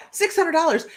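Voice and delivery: can't believe how cheap; disbelief. Real, not acted. Repeating the cheap Reykjavik fare to viewers, amazed.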